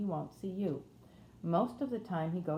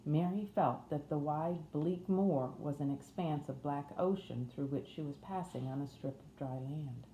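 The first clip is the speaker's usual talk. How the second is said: ordinary delivery, nothing marked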